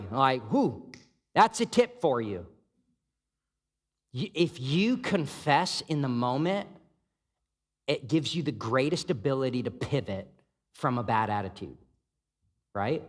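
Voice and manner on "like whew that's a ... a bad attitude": ordinary talk, nothing marked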